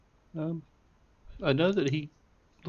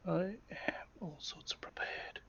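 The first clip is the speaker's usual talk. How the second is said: soft, voiceless whisper; whispered aside; 5 semitones higher, 10 dB quieter than usual. Real, not acted. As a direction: murmur it as a sly aside.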